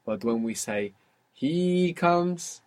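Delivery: ordinary delivery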